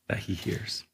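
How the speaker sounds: hushed whisper. Real, not acted. Pastor closing his point to church, soft whisper. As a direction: breathe it out in a whisper.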